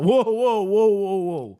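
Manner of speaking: exaggerated startled whoas, dying away; mock alarmed whoa. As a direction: rear back in mock alarm. Reacting to two-hour delay.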